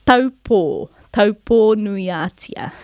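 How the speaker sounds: "Taupo nuiatia" slowly, vowels pure and full; careful Māori pronunciation. Shown as a demonstration. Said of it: "blowing out a candle together".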